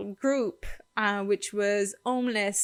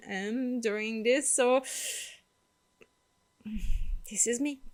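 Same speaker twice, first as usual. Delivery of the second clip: normal talking voice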